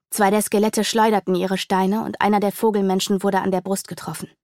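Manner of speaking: usual speaking voice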